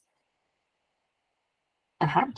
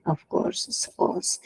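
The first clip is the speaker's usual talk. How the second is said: whispered aside; 7 dB quieter, slower than usual. Real, not acted. Muttered aside after example.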